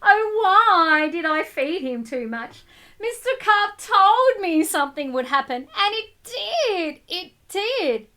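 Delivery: put-on lively storybook reading to children; read-aloud narration. A narrator's reading. Teacher reading a picture book to the class, lively read-aloud.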